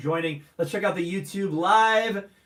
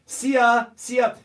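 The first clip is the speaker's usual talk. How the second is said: louder, higher, bright; called greeting; 5 semitones higher, 7 dB louder than usual. Real, not acted. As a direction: call the greeting out. Reading Hungarian greeting.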